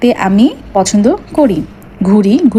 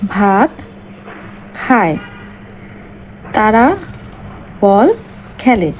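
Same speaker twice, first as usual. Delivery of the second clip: each word set apart by long pauses; slow dictation; slower than usual. Shown as a demonstration. Teacher dictating sample sentences to pupils, slow and spaced. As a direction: dictate word by word so children can write. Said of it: "তো দেখো আমি কি করেছি".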